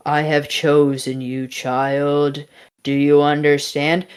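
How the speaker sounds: a hint of godlike pronouncement; solemn voice. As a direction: intone it evenly to a mortal. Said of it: "she sort of goes back and forth with this voice".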